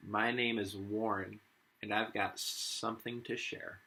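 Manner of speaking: lively picture-book read-aloud as Warren the wombat; reading to children. Acted. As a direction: read it to the class with a touch of Aussie flair. Teacher reads picture book aloud.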